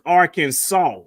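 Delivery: "Arkansas" as AR-kin-saw, last S silent; correct pronunciation. Shown as a demonstration. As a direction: say "Arkansas" slowly, ending in "saw". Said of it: "So it's pronounced as".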